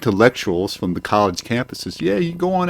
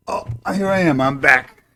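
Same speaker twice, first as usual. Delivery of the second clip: usual speaking voice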